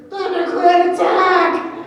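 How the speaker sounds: high, loud war cry, drawn out; battle cry. Retold or imitated. Brandon calling the clan to charge, a loud high war cry. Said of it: "all I hear is Brandon".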